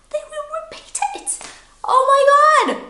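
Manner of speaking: very high squeal building to loud gasp; mock amazement. Teacher teasing learners that people repeat questions, mock amazement. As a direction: feign huge shock, high and over the top.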